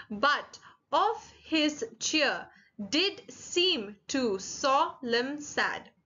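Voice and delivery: very slow, each syllable set apart; counting out syllables. Shown as a demonstration. Teacher counts a poem line with students, syllable by syllable. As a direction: read it beat by beat for counting. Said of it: "then just let's count together".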